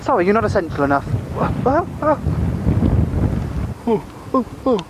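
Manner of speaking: rising high squeaks; squeaky ventriloquist dummy. Acted. Puppet squeaking exclamations.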